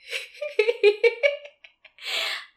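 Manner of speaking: high, loud drawn-out laughter; long laugh. Real, not acted. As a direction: laugh hard before answering. Laughs when asked to question him.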